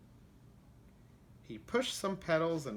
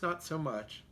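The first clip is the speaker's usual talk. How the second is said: ordinary delivery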